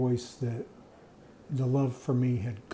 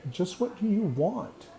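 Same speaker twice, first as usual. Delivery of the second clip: a higher, pointed voice in his head asking; a higher inner voice cutting in; 7 semitones higher than usual. Retold or imitated. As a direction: let the inner voice jump up in pitch, challenging. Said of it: "I hear this voice in my head that says".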